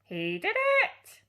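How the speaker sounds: very high, slow shrieking squawk; parrot shriek. A narrator's reading. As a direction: shriek it like a tattling bird. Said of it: "the purple parrot shrieked".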